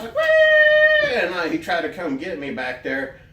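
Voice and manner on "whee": very loud, piercingly high squeal; excited high "whee". Retold or imitated. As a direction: squeal it like flying over the couch. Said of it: "He'll be like".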